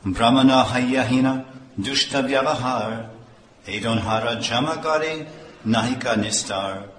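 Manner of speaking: chanted Bengali verse reading; verse recitation. Acted. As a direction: intone the scripture verse.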